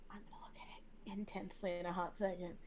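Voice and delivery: hushed, whispered confidence; whispered aside. Real, not acted. Player confiding the plan to look soon, whispered aside. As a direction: whisper it like a secret.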